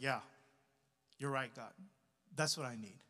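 low, soft retold admission; subdued acceptance. Pastor retells his answer to God, subdued.

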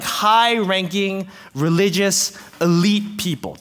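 usual speaking voice

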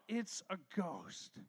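slow, hushed, low mock fright; understated fearful cry. A narrator's reading. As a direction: play the fear softly and drawn out. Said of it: "In their fear, they cried out".

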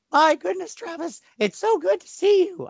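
very high sing-song cartoon kid voice; high, sweet, sing-song skeleton boy voice; squeaky cartoon child voice. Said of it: "I really can't affect that voice anymore".

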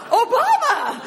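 high, loud cheer with a laugh; cheering the name back at a stranger; excited cheer. Retold or imitated. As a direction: shout it back with delight. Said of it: "And I was like".

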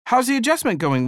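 high, polite small talk; polite Liz. Retold or imitated. Liz asking the couple politely, high and light. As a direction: make polite conversation, lightly. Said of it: "Liz asked, being the polite conversationalist".

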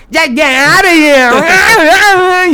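high, loud, slow mocking echo of her; mocking mimicry. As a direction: parrot her words back in a taunting voice. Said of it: "he would, like, mimic her".

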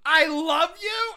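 mock adoring. Podcaster mocking Lori's switch to adoring her brother, mock gush. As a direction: gush it, overdoing the affection.

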